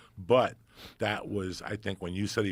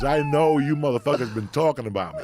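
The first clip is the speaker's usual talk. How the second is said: loud, higher mock Pryor line; imitating Pryor; 7 semitones higher, 10 dB louder than usual. Retelling Pryor's joke to a friend, loud imitation. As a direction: throw out the line like Pryor on stage. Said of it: "When he goes".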